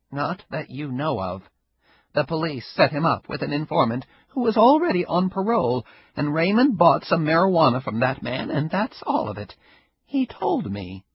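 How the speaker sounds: put-on old Ida, sly. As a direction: play the sly old neighbor enjoying herself.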